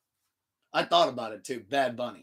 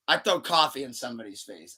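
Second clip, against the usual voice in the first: usual speaking voice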